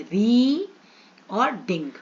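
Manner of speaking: "री" long and loud, then a separate "ding"; teacher breaking the word into "री" and "ding"; split into syllables. Shown as a demonstration. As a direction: sound out "री", pause, then "ding". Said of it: "इसमें दो syllable है".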